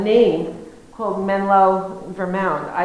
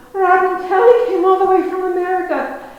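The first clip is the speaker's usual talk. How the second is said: high, loud imitation of a thrilled farmer; hollering proudly to the whole shop, laughing through it; excited announcing; 9 semitones higher, 9 dB louder than usual. Old farmer showing the American off to the shop, high and loud.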